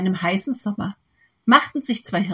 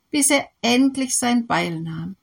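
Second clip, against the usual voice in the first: plain reading voice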